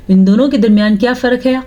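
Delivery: ordinary talk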